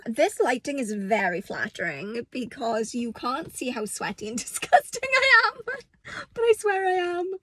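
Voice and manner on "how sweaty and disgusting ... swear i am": high giggly confession; laughing. Real, not acted. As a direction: laugh through the words. Laughs mid line.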